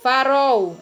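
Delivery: "Farol" with the L sounded as the vowel U; "Farol" ending in a U sound instead of L; final L as U. Shown as a demonstration. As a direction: say "Farol" as if written Farou. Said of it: "existisse a vogal U".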